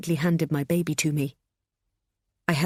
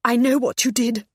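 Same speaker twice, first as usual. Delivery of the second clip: accusing; 7 semitones higher, 5 dB louder than usual. A narrator's reading. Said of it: "she said".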